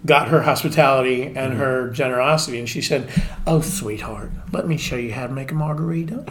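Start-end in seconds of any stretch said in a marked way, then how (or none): 3.5-6.2 put-on gentle Southern lady accent, kindly teaching; a sweet Southern hostess coaching a young bartender, soft Southern accent. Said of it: "It's a really good accent".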